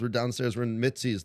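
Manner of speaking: ordinary talk, nothing marked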